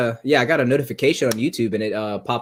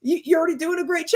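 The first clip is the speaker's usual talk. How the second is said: high mock manager voice. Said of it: "And the manager goes".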